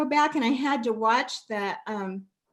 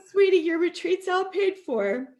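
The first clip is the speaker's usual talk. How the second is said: usual speaking voice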